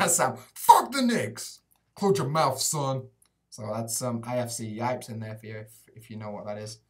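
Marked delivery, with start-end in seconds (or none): 0.1-3.1 mock trash talk. Mock taunt to chatter.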